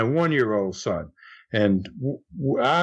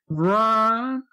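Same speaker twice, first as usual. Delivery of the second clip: a hint of Jim calling his name, a little higher; imitating Jim; 6 semitones higher than usual. [name] greets Ron just like Jim, lightly mimicked. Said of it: "just like Jim".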